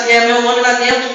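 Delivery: normal talking voice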